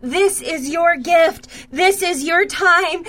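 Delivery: impassioned charismatic prayer, building; fervent prayer. Acted. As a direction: pray it out with rising passion over her. Fervent prayer over the listener.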